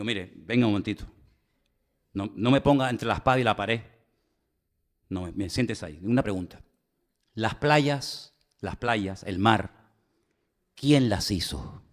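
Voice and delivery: gently sit her down and ask; calm reassurance. Retold or imitated. Pastor calmly answering the churchgoer's question about beaches.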